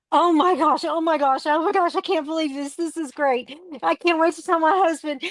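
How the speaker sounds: high, fast, gushing retold joy; breathless, high-pitched delight retold; excited gush. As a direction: imitate her squealing thrilled reaction. Retold thrilled phone reaction.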